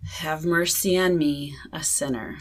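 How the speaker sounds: slow prayer fading to a whisper; slow breath prayer. Shown as a demonstration. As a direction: let it trail into a whisper.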